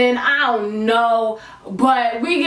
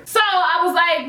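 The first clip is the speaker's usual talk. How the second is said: usual speaking voice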